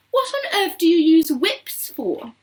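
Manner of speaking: high, loud, baffled question; astonished cry. A narrator's reading. Veruca questioning Wonka about whips, astonished cry. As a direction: demand an answer in disbelief. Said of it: "cried Veruca Salt".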